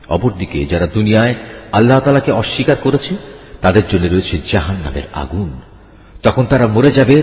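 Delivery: ordinary delivery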